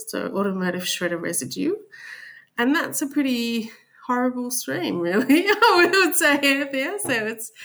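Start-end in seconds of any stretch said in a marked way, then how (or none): 5.0-7.5 laughing. Real, not acted. Guest jokes about horrible waste.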